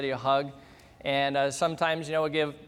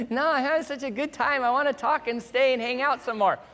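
higher, bubbly imitation of his wife; teasing wife voice, raised pitch; playful wife; 7 semitones higher than usual. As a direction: mimic her happily protesting. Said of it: "she's like".

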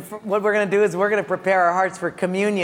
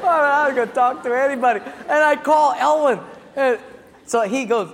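ordinary delivery

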